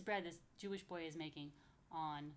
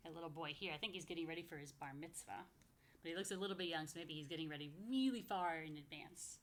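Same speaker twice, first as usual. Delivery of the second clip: ordinary delivery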